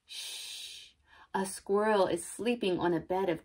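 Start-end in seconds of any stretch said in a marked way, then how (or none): 0.0-0.9 soft, drawn-out shush; very quiet, voiceless shh; hushing. Acted. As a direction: hush the listeners so nobody wakes it. Hushing for the sleeping squirrel.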